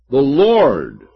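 loud, weighty stress on "Lord"; bold. Acted. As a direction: proclaim "The Lord" with confidence. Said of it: "let us boldly say".

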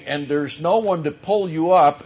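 ordinary talk

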